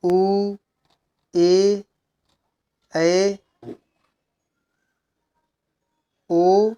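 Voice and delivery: उ, ए, ऐ, ओ each drawn out; long vowels. Shown as a demonstration. Teacher reading out long vowels, उ to ओ each held. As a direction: hold उ, ए, ऐ, ओ long, with pauses between. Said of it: "ह्रस्व से अधिक समय लगता है".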